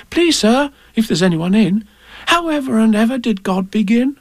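small and polite at a grand door; polite child. A narrator's reading. Ann asks at the door.

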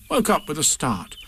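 ordinary delivery

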